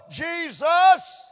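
high, loud shout of Jesus. Acted. Preacher shouting the name to the congregation, loud. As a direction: cry his name out with full force.

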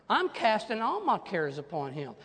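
usual speaking voice

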